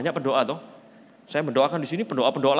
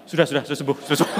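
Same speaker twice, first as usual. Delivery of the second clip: louder, rushed through laughter; laughing hasty reply; 4 dB louder, faster than usual. Retold or imitated. Embarrassed man insisting he is healed, laughing. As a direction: hurry to end it, laughing.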